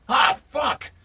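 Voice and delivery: sudden high shout of pain; old man cries out in pain; pained yell. Acted. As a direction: yelp it out loud as the staff strikes. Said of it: "he's like".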